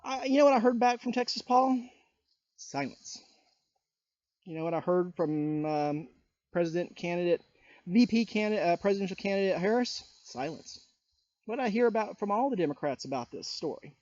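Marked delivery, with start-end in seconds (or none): 2.6-3.2 said low and much softer, a pointed drop; hushed and pointed; dramatic silence. Acted. Rhetorical answer, nobody replied. 10.2-10.8 said low and much softer, a pointed drop; hushed and pointed; dramatic silence. Acted. Rhetorical answer, nobody replied.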